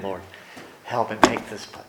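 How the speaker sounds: soft, fast murmured prayer; quiet prayer. Retold or imitated. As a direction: pray quickly under your breath. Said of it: "all of a sudden I got quiet and I'm praying".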